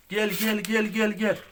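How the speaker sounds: high, loud come-here call; calling animals. Real, not acted.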